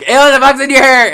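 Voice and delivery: very high, loud imitated shriek; retold alarmed shriek. Lorena spotting a bug in narrator's hair, alarmed shriek. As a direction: shriek it like a disgusted bystander.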